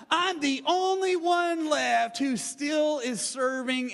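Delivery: loud, high whining insistence on only; self-pitying complaint. Retold or imitated. Elijah complaining to God, loud self-pity.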